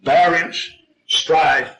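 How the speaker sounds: ordinary delivery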